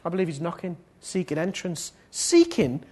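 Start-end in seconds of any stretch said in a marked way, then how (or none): 2.1-2.8 raised, pressing preacher's voice; high, emphatic exhortation; impassioned preaching. Real, not acted. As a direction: urge the congregation at full force. Preaches at full force.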